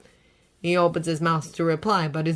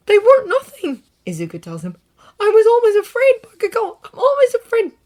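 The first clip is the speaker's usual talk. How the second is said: fearful; 8 dB louder than usual. A narrator's reading. Izuku admitting fear.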